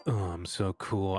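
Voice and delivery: ordinary delivery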